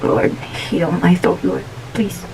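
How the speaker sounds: slow, soft plea to God; quiet prayer. Retold or imitated. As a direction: pray softly, trailing down on please. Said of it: "I always pray".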